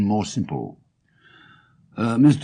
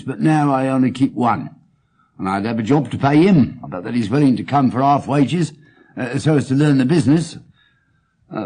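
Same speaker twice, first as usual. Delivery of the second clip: higher, louder voice of a chatty pawnbroker; eager pawnbroker; 6 semitones higher, 4 dB louder than usual. Acted. Pawnbroker telling Holmes about his shop, eager and chatty. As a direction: play the earnest London shopkeeper.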